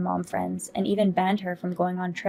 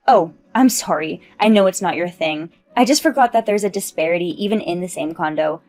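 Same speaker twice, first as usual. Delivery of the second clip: condescending mock apology; 3 semitones lower, 7 dB louder than usual. [name] mocks lower-floor neighbour.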